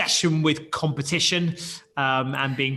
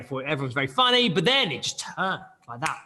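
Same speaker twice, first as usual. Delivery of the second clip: usual speaking voice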